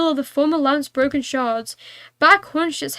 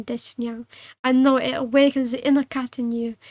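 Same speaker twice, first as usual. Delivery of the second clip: usual speaking voice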